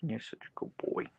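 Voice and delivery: hushed whisper to the dog. Real, not acted. Owner whispering praise to her husky. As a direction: whisper it softly.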